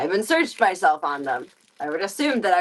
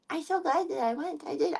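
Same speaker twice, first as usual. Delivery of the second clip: cooing to cat; 7 semitones higher, 4 dB quieter than usual. Shown as a demonstration. Repeated to cat, higher.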